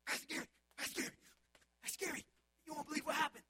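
breathless, high, loud rush of excitement; a child shouting news to the pastor, excited; excited kid. Retold or imitated. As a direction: burst in like an eager kid.

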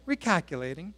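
a soft, even navigation-app voice with a slight rise; calm GPS voice. Retold or imitated. Pastor imitates the phone GPS, calm and polite. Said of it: "It just simply says".

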